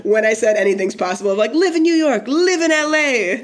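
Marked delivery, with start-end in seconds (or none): 1.5-3.4 put-on high, loud spoiled rich girl; bright snooty preppy girl. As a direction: squeal it like a pampered daddy's girl. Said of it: "this is a character".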